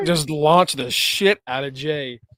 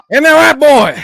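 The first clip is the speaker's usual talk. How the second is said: loud taunt; 10 semitones higher, 11 dB louder than usual. Retold or imitated. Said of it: "And him just".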